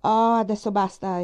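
loud, impatient outburst; exclaiming. A narrator's reading. Captain cutting off married friends, loud and exasperated. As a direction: cut the talk short, exasperated. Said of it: "esclamò il capitano Wentworth".

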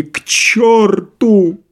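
high, long wailing cry; tearful shout. A narrator's reading. Doctor runs out after visitors, tearful shout. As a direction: cry it after them, drawn out. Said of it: "крикнул он плачущим голосом".

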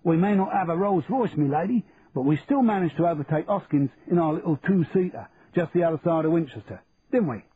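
cheeky London Cockney voice; broad Cockney accent. Acted. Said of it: "said Charlie in a broad Cockney accent".

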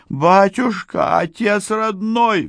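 very high, drawn-out wail; slow, high-pitched begging cry; squealed plea. A narrator's reading. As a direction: squeal in panic, stretching the words. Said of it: "взвизгнул пасечник".

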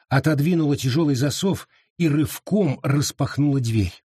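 usual speaking voice

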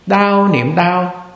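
drawn-out, very slow mental noting. Shown as a demonstration. Monk showing students how to note pain, very slowly. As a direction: let each word hang like a meditation note. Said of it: "vì vậy quý vị mới niệm".

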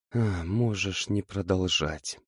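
slow, soft, sinking reply; subdued, slow resignation; gloomy. A narrator's reading. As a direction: darken and stop him softly. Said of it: "помрачнела девушка".

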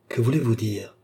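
tentative, hopeful question; shiver of hope. A narrator's reading. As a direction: ask with a faint thrill of hope. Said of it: "demanda-t-elle avec un frisson d'espoir". (frisson d'espoir retenu)

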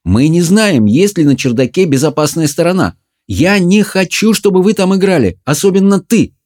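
high, emphatic motherly prohibition; insistent scolding, pointed at one child; stern warning. A narrator's reading. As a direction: lay down the law, climbing in pitch. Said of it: "глядя прямо на Джареда".

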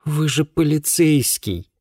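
slow, high, incredulous exclamation; astonished reproach. A narrator's reading. Girl reminding an officer of his job, incredulous. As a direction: draw it out high in disbelief. Amazed girl challenges the policeman.

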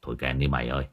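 soft, low aside in character; low, muted request. A narrator's reading. Drunk mourner asks the horn player to stop, low and muted. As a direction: drop to a quiet, low murmur.

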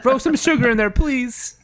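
high, comic pleading through a laugh; mock near-crying. Man asking for sugar in his drink, mock near-crying. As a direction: beg for a sweeter drink, hammed up. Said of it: "he's about to cry from drinking".

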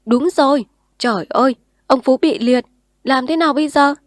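alarmed crowd shouting; clamoring voices. A narrator's reading. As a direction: everyone crying out at once. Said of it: "Tiếng người nhao nhao".

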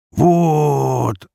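high, loud, long-drawn satisfaction; triumphant, drawn-out exclamation; delighted drawl. A narrator's reading. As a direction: stretch the word out with glee. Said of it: "обрадованно протянул Михайлов".

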